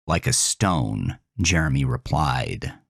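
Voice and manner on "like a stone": plain reading voice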